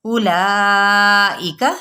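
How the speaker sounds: اولائکا slow, the waw silent, the alif stretched; tajweed recitation. Shown as a demonstration. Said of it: "جلدی سے".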